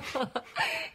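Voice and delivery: helles, spöttisches Lachen; hohes Auflachen. Acted. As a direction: lacht hoch und amüsiert auf. Lacht über Zeitungsartikel. (hohes, belustigtes Auflachen)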